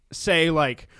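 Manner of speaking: ordinary delivery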